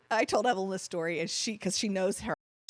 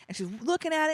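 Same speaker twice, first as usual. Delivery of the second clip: ordinary delivery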